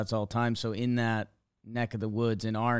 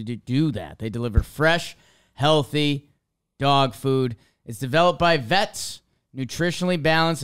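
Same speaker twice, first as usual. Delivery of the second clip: punchy podcast sponsor read with stressed key words; emphatic, measured ad-read delivery; 2 semitones higher, 6 dB louder than usual. Acted. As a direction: sell it like an upbeat announcer. Reads a sponsor ad.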